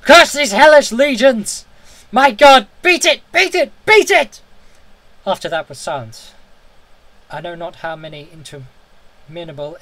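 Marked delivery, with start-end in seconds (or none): 0.0-4.4 very high, loud, slow shriek of horror; horrified shriek. A narrator's reading. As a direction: shriek as the horror takes him. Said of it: "rose to a shriek fraught with all horror".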